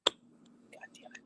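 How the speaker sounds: slow, drawn-out whisper to yourself; slow whispered frustration while stuck; slow whispered curse. Real, not acted. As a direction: breathe the curse out slowly in frustration. Can't recall a title.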